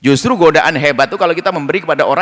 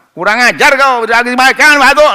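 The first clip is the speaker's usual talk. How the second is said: loud, high mock scolding of a chicken; 8 semitones higher, 8 dB louder than usual. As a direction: snap at the chicken that pecked you.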